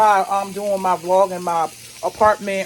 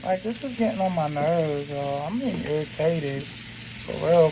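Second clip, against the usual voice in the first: low, subdued grumble of irritation; quietly annoyed aside; real irritation; 4 semitones lower, 4 dB quieter than usual. Real, not acted. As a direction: complain under your breath, fed up.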